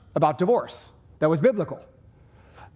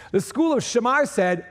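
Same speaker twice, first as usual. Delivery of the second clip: normal talking voice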